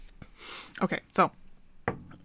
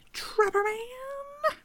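much higher, very slow mock question; incredulous echo; 5 dB quieter, much slower than usual. Real, not acted. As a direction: stretch it out in disbelief. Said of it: "mock questioning".

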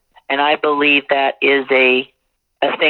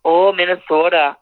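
put-on Minnesota accent; 4 semitones higher than usual. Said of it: "I'll just start to talk like them".

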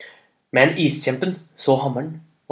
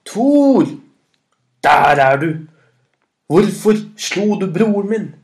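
loud, booming giant bellow; giant's roar; 5 semitones higher, 9 dB louder than usual. A narrator's reading. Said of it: "Og den brølte og ropte".

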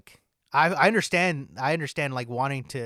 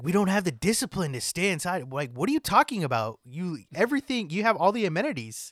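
high-pitched incredulous rant; ranting in disbelief, pitched up; exasperated; 5 semitones higher, 4 dB louder than usual. Retold or imitated. Podcaster mocking people who won't stay inside, exasperated.

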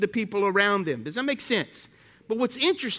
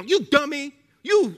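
higher, louder mock insult to a child; a parent's scolding, replayed louder and higher; 6 semitones higher, 4 dB louder, slower than usual. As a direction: imitate a parent yelling you dummy.

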